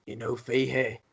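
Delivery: badly faked Glaswegian taxi driver; bad Glaswegian accent. Taxi driver questioning a passenger, in a horrific Glaswegian accent. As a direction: attempt a Glaswegian accent and miss. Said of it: "That was a horrific Glaswegian accent".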